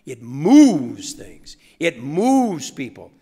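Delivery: each word punched slowly and loudly; slow emphatic. Real, not acted. Speaker preaching to students, slow emphatic punch. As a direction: hammer home "moves".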